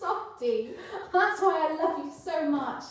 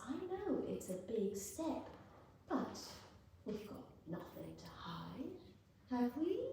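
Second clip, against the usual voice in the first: soft menace; 4 semitones lower, 16 dB quieter than usual. Acted. Low menacing insinuation.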